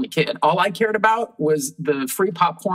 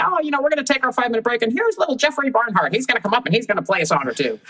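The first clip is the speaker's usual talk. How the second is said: high, fast emcee patter; bandleader announcing; 8 semitones higher, faster than usual. Retold or imitated. Bandleader introducing little Jeffrey to the crowd, upbeat announcer voice. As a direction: hype the kid like a club emcee. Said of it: "So they would say".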